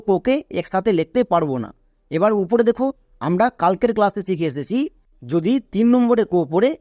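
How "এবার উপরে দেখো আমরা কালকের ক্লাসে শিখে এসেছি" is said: ordinary talk, nothing marked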